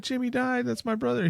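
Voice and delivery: high, stunned mock gasp of disbelief; shocked disbelief. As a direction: play the sister hearing the news cold. Daughter shocked at brother's death.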